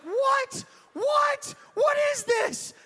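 very high, loud mock outrage; hammed-up disbelieving squeal; shocked kid. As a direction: shriek it like a dismayed child. Son shocked at punishment, acted.

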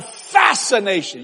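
usual speaking voice

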